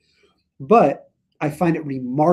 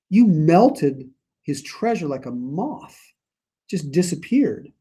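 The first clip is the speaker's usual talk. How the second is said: normal talking voice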